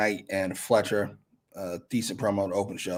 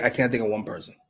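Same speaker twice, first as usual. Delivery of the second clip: usual speaking voice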